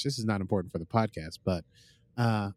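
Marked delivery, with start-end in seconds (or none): none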